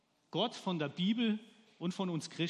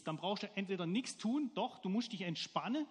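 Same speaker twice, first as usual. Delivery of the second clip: ordinary talk, nothing marked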